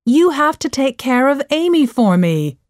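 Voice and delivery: insist, lightly shouting at your daughter; mother's mild shout. A narrator's reading. Mom orders Joe to babysit Amy, mildly shouting. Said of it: "shouted Joe's mom".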